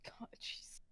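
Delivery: breathy, unvoiced whisper. Real, not acted. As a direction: whisper it in awe. Whispered reaction.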